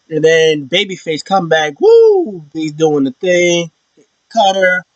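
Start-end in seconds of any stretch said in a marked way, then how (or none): 1.8-2.4 high, loud cheering woo; excited woo. Acted. Fan cheering the babyface comeback, loud woo. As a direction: whoop for the comeback.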